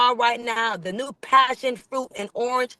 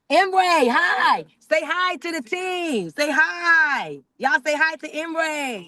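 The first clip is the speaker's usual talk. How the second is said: much higher, slow, beaming welcome; excited greeting; 6 semitones higher, much slower than usual. Real, not acted. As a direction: call out a big happy hello to the boss. Greeting the owner on camera.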